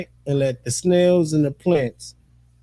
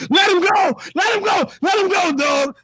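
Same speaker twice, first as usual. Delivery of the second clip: high repeated yell; shouting at dog. Retold or imitated. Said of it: "I was like".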